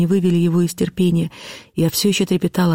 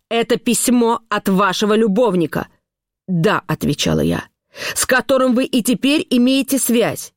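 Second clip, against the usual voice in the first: higher, pressing interrogation; cold interrogating husband; 7 semitones higher, slower than usual. A narrator's reading. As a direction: demand an answer, slow and hard.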